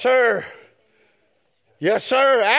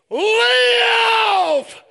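ringing sermon cry; preaching shout; 12 semitones higher, much slower than usual. Real, not acted. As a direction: drag out each word to the rafters.

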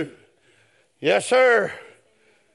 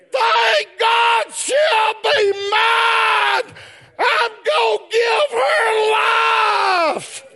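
preaching at full force; 12 semitones higher, much slower than usual. Real, not acted. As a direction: build from "purchase" to a whooping cry. Same quote shouted.

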